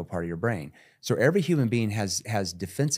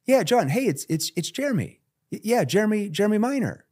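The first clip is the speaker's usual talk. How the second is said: much higher, familiar cold-call opener; raised voice; 12 semitones higher, 4 dB louder than usual. Shown as a demonstration. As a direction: pitch up to sound like an old friend. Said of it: "I raised my voice intentionally".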